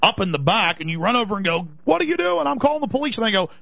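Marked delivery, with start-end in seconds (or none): 1.8-3.2 alarmed homeowner. Pretend. Homeowner confronting burglars.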